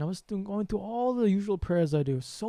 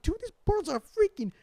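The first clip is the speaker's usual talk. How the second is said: higher and louder, slowed, stunned; astonished dude-style exclaim; amazed exclaim; 6 semitones higher, 5 dB louder, slower than usual. Retold or imitated. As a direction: marvel at the huge birds. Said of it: "And I'm like".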